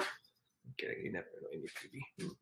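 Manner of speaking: very soft, near-whispered aside; hushed, affectionate whisper; tender reassurance. Real, not acted. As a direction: murmur it low to a beloved pet. Reassuring her dog.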